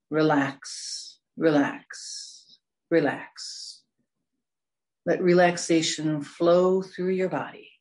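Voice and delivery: slow, spacious meditation voice, long pauses between phrases; guided meditation. Real, not acted. Meditation leader guiding listeners through breaths and body relaxation, slow meditation voice. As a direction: draw the words out to guide them into relaxation.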